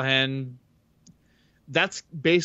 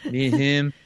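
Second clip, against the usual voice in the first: lisping boxer voice, hammed-up; Mike Tyson impression. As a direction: lisp every s like a heavyweight champ. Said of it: "You sound like, you sound like Mike Tyson".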